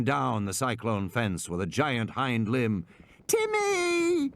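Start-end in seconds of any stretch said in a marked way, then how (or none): 3.3-4.3 put-on screaming child. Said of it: "Lex screaming".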